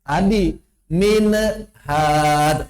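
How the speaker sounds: "min, had" with an extra sound pushed into the nun, slow; added-nasal example. Shown as a demonstration. Teacher demonstrating the wrong added-sound reading of "min, had". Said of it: "Contoh ditambah".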